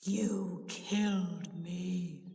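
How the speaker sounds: very slow, drawn-out ghostly accusation; deep spectral moan of words; deep, terrible voice. A narrator's reading. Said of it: "in a deep, terrible voice".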